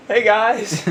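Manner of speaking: high, loud schoolmate impression; Edward Caesar. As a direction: mimic a classmate, pitched well up. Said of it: "He basically sounds".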